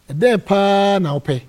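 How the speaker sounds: slow, insistent question with a drawn-out paa; emphatic question. Retold or imitated. Donor asking the pastor what he wants, emphatic. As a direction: stretch out PAA, slow, high and loud.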